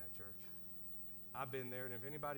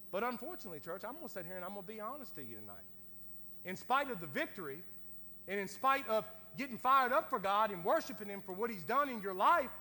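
loud, rising preacher's call; preaching, building; 9 semitones higher, 13 dB louder than usual. Acted. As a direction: push the point home to the church.

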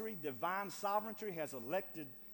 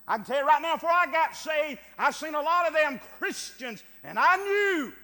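loud, higher preacher's shout; full-force preaching; 9 semitones higher, 17 dB louder than usual. Acted. Preacher recalling life before being saved, loud preaching. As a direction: belt the testimony out.

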